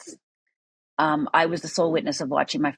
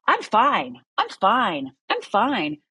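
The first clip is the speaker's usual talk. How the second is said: higher, insistent mock reassurance; putting on fine; 5 semitones higher, much slower than usual. Survivor hiding pain from others, put-on fine. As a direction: insist three times that all is fine. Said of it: "on the outside, I was like".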